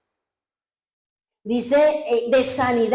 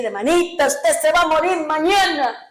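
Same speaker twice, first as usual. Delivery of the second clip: louder, higher mock pronouncement; anuncio profético; 5 semitones higher, 4 dB louder than usual. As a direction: deliver a grim prophecy, raised and emphatic. Actúa un anuncio profético de muerte. (anuncio profético en voz alzada)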